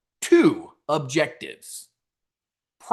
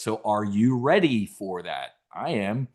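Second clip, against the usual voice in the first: ordinary talk, nothing marked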